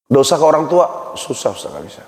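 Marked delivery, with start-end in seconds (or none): 1.2-2.0 mock limp, much softer whine; mock weak whine. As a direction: imitate a man who can't cry, voice sinking low. Mocking a man who cannot cry.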